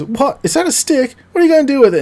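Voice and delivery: very high, mocking little bear voice; hammed-up cub jeering; taunting Baby Bear. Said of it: "Baby Bear goes".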